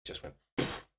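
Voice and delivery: breathy unvoiced poof sound; imitating the burst of poo, a breathy whispered poof.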